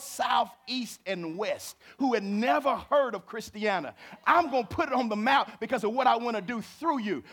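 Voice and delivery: high, loud preacher's exhortation; full-force preaching. Acted. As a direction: drive it home to the congregation.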